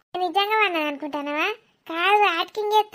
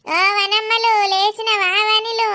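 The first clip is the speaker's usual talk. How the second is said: put-on drawn-out, noisy baby wake-up call; boisterous grandmotherly calling; loud calling; 4 dB louder, slower than usual. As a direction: call the baby with big stretched endings. Grandmother noisily calls baby awake.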